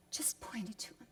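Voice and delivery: hushed whisper; sorrowful hushed whisper. Acted. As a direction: drop to a whisper, leaning in. Hushed aside in sermon.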